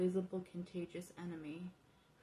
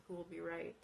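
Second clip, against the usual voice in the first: usual speaking voice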